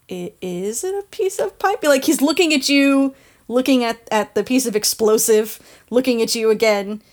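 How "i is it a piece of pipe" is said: a hint of a nervous worker, higher and softer; timid question. Rattled worker asking about the pipe, timid. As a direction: ask it meekly, unsure of yourself. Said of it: "where he's just like".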